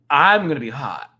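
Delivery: mock heated. Shown as a demonstration.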